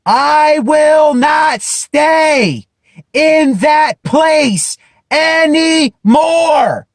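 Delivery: very slow, high, shouted vow; out loud. Shown as a demonstration. To himself, refusing to stay there, slow shouted vow. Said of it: "No, I'm saying out loud".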